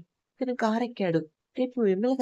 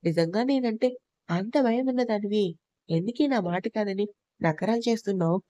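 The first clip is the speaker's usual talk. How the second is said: usual speaking voice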